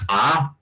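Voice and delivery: "a" launched by an ejective k pop; glottalized k'a. Shown as a demonstration. Teacher shows the glottalized sound, "a" after a popped k. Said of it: "nuestra variante es glotalizada".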